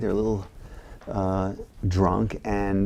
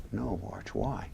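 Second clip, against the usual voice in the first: soft, much quieter question; hushed Michael; 2 semitones lower, 11 dB quieter, slower than usual. Retold or imitated. Michael gently asking Archie why, much softer. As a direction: ask it barely above a murmur. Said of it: "So Michael's like".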